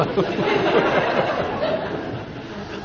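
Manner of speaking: a long, unvoiced chuckle; airy laugh. Real, not acted. Laughs at the memory.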